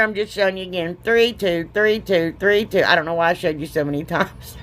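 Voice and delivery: usual speaking voice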